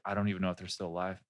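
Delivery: low, soft, hurried grief; mock-tragic. Answering the worried listeners, deadpan sorrow. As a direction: murmur it as if bereaved. Punchline of the fake tragic story.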